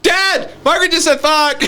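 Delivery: put-on high-pitched kid calling out to Dad; tattling kid. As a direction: tell on your sister in a high child's voice. Child tattling to dad in retold story.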